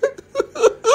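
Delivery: a burst of giggling held back; stifled giggles. Real, not acted. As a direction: laugh slipping out before the take. Breaks up before birthday video.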